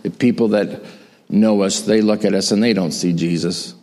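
ordinary talk

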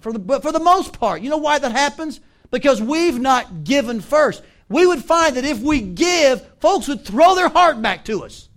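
emphatic preaching. Real, not acted. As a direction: drive the point home to the congregation. Preacher drives the point home.

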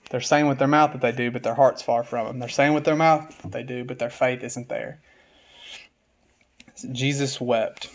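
normal talking voice

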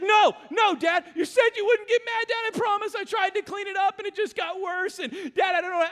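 put-on a scared kid begging dad not to be mad; panicked pleading child.

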